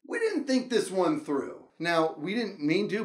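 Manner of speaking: ordinary delivery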